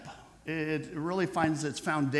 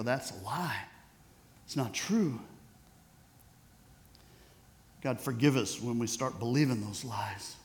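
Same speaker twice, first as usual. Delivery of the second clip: prayer; 3 semitones lower than usual. Acted. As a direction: pray it low and sincere for the congregation. Pastor leads closing prayer.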